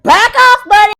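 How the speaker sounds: loud, high mock yell; aggressive. Wife warding off her husband, aggressive high shout. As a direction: fend him off at full volume. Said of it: "I love how aggressive she is".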